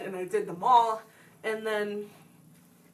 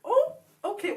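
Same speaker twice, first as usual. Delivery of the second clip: high, surprised acceptance, retold; taken aback; 10 semitones higher than usual. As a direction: caught off guard, then going along. Retold surprise at lead monologue.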